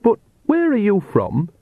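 put-on high, sweet little old lady; soft old biddy voice. A narrator's reading. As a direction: play the harmless granny. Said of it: "in her soft old biddy voice".

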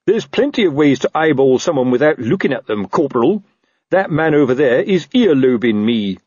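blustering, aggrieved boss; paranoid captain. Acted. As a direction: accuse them with puffed-up suspicion. Narrator voices paranoid Captain Colon.

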